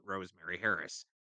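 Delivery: normal talking voice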